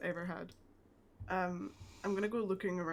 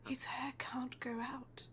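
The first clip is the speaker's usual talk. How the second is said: whisper; 5 semitones higher, 6 dB quieter, slower than usual. Pretend. Sneaking out of sleeping Gregor's room.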